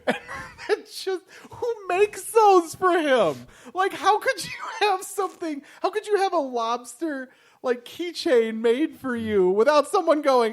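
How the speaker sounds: high-pitched, cracking up while talking; laughing incredulity. Real, not acted. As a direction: squeal it out through real laughter, helplessly incredulous. Cracking up over absurd keychain joke.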